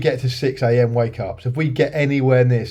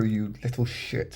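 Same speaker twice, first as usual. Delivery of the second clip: mock muttered insult; 2 semitones lower, 10 dB quieter, slower than usual.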